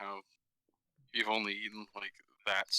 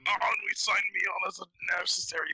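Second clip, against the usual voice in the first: put-on character voice; 9 semitones higher, 6 dB louder than usual.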